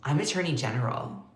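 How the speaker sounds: a cold, quiet correction; softly delivered snippy retort; curt correction. Retold or imitated. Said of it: "Pam Bondi pauses and goes".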